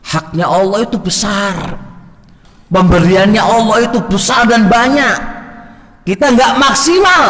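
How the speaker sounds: impassioned preacher's cry; fiery preaching. Acted. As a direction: drive the point home with fervour. Preaching at full force.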